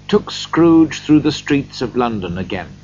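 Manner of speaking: ordinary delivery, nothing marked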